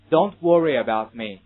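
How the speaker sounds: slow, loud American "Don't worry about me"; American English. Shown as a demonstration. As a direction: model American "worry" slowly and loudly, with a schwa. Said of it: "这是美式读法". (美式发音慢速大声读worry)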